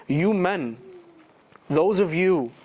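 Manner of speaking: normal talking voice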